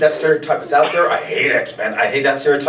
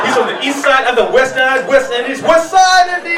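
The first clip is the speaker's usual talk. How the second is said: higher and higher to a loud cry; building comic shout; 9 semitones higher, 4 dB louder than usual. Acted. Comic riffing to the crowd, building to a high shout.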